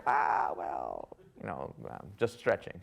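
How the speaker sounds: mock excuse, pitched right down; sheepish backpedalling. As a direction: imitate someone lamely backing out. Hand-raisers backing down, making excuses.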